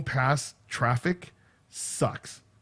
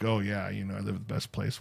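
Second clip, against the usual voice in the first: lower, much softer mock of smug locals; 6 semitones lower, 10 dB quieter than usual. Mocking coastal folks who brag about home, low and soft. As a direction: mutter it like a mildly smug know-it-all. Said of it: "you're all high and mighty thinking like".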